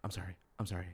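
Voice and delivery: a low murmured string of apologies; mimicking Prince apologizing. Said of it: "repeatedly say".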